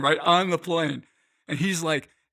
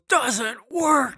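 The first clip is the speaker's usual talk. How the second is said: retold yell, pitched far higher; Penn Jillette screaming; 10 semitones higher than usual. Magician shutting down a passenger, high mock yell. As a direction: bark it back at him, high and sharp. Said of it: "He just screams at me".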